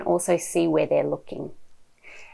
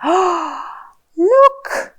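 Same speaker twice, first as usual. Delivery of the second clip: big gasp, then high "look"; playful surprise; 12 semitones higher, 5 dB louder than usual. Shown as a demonstration. Showing a little one a new page, mock surprise. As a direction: gasp and point it out with wonder. Gasps at picture for child.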